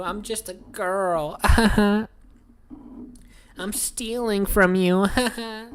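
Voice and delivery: hammed-up girly gloating; mocking girl. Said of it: "They're like".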